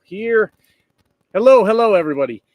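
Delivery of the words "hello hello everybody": loud greeting. Real, not acted.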